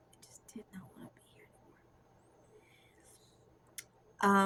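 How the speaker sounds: fading whisper. Retold or imitated. Repeating her old despair, a fading hurried whisper. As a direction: let the repeat fade away to a breath.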